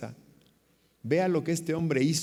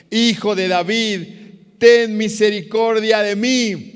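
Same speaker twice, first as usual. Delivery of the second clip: drawn-out, high, full-voiced shout; crying out harder; 9 semitones higher, 12 dB louder, slower than usual. Silenced blind man calls to Jesus even more, crying out. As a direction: belt out a slow, pleading cry. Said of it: "pero él clamaba mucho más".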